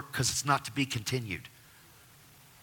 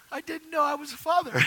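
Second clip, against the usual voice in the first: choked-up confession. Retold or imitated. Choked confession of failed fatherhood.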